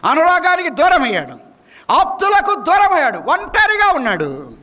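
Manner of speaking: high, ringing pulpit lament; impassioned preaching. Real, not acted. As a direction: cry out each loss to the congregation. Preaching climax on loneliness.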